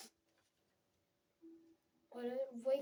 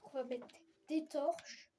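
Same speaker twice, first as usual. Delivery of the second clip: ordinary talk, nothing marked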